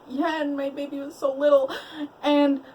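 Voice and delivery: high, whimpering imitation of pleading; mock tearful plea. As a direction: plead with a put-on sob. Mimicking the cousin's tearful plea.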